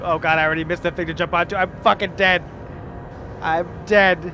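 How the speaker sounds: panicked yell. Real, not acted. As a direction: yell it out as doom nears. About to die in game.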